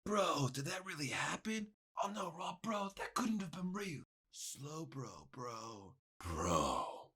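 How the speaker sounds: hushed disbelief, fading away; barely voiced morning-after astonishment; stunned murmur. Retold stunned morning-after talk with roommate.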